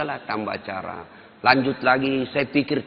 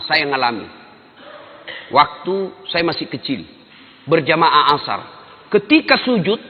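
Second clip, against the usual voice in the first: ordinary talk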